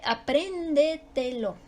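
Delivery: slow apréndetelo with a long PRÉN; stretched stressed syllable. Shown as a demonstration. Teacher showing an accented word, slow apréndetelo, stressed PRÉN. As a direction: lean on PRÉN, then measured syllables in apréndetelo. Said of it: "es sobre esdrújula y se acentúa".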